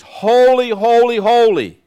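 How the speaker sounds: holy, holy cried out high and loud; ringing proclamation. Shown as a demonstration. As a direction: let holy ring through the heavens. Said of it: "They let it ring in the heavenlies".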